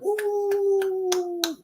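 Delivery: usual speaking voice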